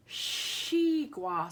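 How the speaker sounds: xigua said as shee-gwa in Mandarin; Chinese xigua. Shown as a demonstration. Teacher names watermelon in Chinese for pupils, shee-gwa. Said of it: "it sounds like it just should start".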